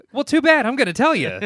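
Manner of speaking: high, loud comic insistence; loud mock-indignant outburst; mock defiant shout. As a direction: shout over the refusal, playfully bossy. Mock defiance after being told no.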